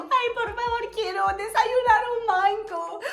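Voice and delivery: put-on high, slow, near-tearful child plea; pleading child voice, almost crying; whiny begging. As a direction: whine for a mango like a spoiled kid. Kids begging for fruit.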